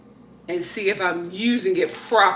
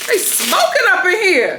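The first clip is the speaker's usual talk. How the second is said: shocked exclaim; 10 semitones higher, 5 dB louder than usual. Real, not acted. Smells smoke on used product.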